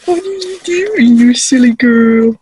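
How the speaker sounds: fond, slow sing-song scolding; playful pet talk. Real, not acted. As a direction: draw it out teasingly to a pet. Cooing to her pet sheep.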